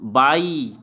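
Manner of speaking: बाई with a long "ee" at the end; stretched ending. Shown as a demonstration. Teacher showing ee comes here too, बाई drawn out. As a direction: draw out the final ई of बाई. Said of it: "यहाँ पर भी ई आया".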